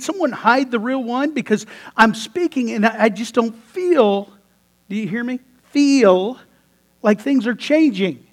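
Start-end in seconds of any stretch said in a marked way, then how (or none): none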